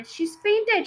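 high, loud alarmed cry; teacher crying out in alarm. A narrator's reading. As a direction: call out in alarm. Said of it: "she cried".

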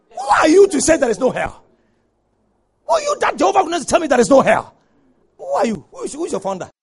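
loud, high-pitched rebuke; indignant challenge. Real, not acted. Preacher challenging people who say there is no hell, indignant. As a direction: confront the deniers forcefully.

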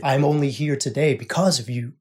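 normal talking voice

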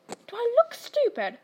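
high, sneering snarl. A narrator's reading. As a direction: snap it at the boy with contempt. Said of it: "snarled Uncle Vernon".

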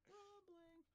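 squeaky high cartoon wail; high mocking cry. Player repeats rumbling mockingly.

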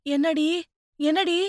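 high-pitched, anxious asking; worried mother's query; concerned motherly questioning. Acted. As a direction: ask in alarm, voice lifted high. Older woman arrives, sees distress.

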